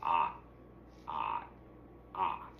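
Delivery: low, drawn-out frog croaks; low frog voice croaking. A narrator's reading. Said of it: "kept croaking".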